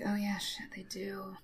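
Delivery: very soft, dropping to a whisper; sheepish undertone. Acted. Galvatron realizing they still have the bomb, hushed. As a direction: concede it under your breath. Villain sheepishly concedes the point.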